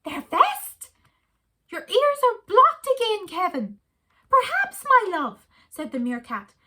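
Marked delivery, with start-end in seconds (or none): 0.0-0.9 wife's voice. A narrator's reading. Kevin's wife doubting. 1.7-3.8 high, louder scolding wife voice; a wife chiding her deaf husband; wife's scolding. A narrator's reading. Wife scolding Kevin, high and sharp. 4.3-5.5 high, loud squeaky meerkat; a chirpy little meerkat answering his love. A narrator's reading. Meerkat Kevin to his wife, high and eager.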